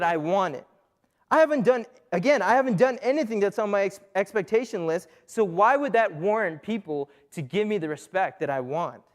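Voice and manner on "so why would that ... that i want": ordinary delivery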